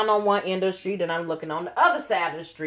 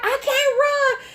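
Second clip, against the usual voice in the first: much higher, slow I can't run; high pleading protest; slower than usual. Retold or imitated. Refusing to run while pregnant, high pleading. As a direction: plead with her, drawn out.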